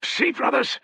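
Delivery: high, loud excited cry; overjoyed at the omen. Acted. Soldier crying out at the omen, excited.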